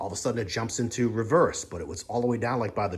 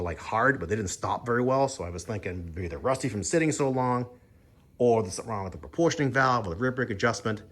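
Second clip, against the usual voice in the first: normal talking voice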